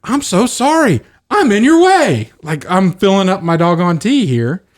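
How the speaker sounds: mock-polite. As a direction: overdo the politeness, way up high. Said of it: "I did it in a polite way".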